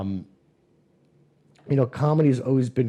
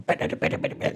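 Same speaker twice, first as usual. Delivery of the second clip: imitated rapid-fire blows; rapid punching noises; 5 semitones lower, much faster than usual. Patient describing stomach pain as a beating, rapid punch sounds. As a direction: rattle it out like a flurry of punches.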